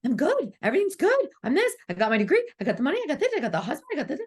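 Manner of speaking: high, bright mock bragging, a laugh in it; fake-confident clients. Mocking clients who insist all is good, in a high bragging voice. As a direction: parody the everything's-fine act.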